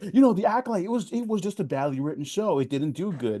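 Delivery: high-pitched mock critic, easing down; mocking imitation. Mimicking the show's critics.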